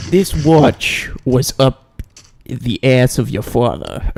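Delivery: slow, halting actor impression; Christopher Walken. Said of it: "They played that fantastic role in".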